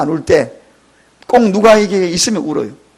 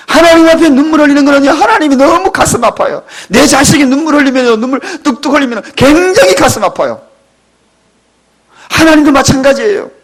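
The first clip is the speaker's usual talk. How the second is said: higher, louder preaching at full force; impassioned preaching; 7 semitones higher, 3 dB louder than usual. Acted. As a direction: drive the point home to the congregation.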